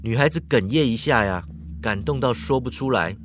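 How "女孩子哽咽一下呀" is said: ordinary delivery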